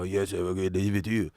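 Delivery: low, soft mock Chinese accent, lightly put on; a quiet imagined stock Chinese accent; expected Chinese accent. Muffin-shop guy's expected reply, mock Chinese accent. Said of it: "just Chinese accent back".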